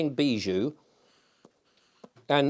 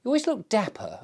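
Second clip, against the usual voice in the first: higher, quoting a friendly passer-by; quoting a compliment; 8 semitones higher than usual. Retold or imitated. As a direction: echo the compliment brightly. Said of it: "they said".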